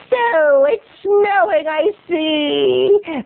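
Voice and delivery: put-on high, slow elderly warble; old lady voice. Old neighbor hinting about snow, old-lady voice. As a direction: coyly open small talk as an old woman. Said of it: "That's my old voice".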